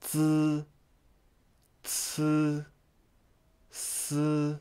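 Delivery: Zi Ci Si soft, drawn out, buzzed vowel; careful pronunciation demo. Shown as a demonstration. Teacher asks learners to listen carefully, careful demo. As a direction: hold each syllable level for learners to copy. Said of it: "listen carefully".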